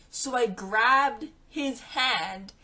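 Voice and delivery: ordinary talk, nothing marked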